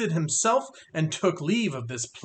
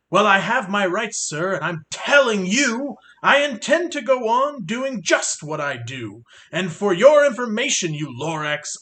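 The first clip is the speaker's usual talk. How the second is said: yelling; 5 dB louder than usual. Acted. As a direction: shout him down furiously. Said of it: "I yelled at the Lorax".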